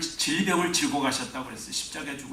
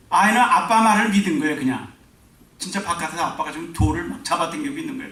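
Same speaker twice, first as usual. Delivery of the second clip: normal talking voice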